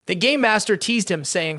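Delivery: ordinary delivery, nothing marked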